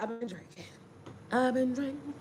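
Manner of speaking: said very softly, almost breathed; hushed confession. Real, not acted. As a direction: confess under your breath. Confessing aside.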